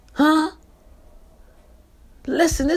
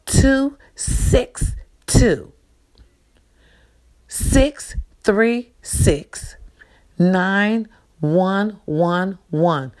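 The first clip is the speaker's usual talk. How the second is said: slow dictation; much slower than usual. Shown as a demonstration. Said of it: "the number is".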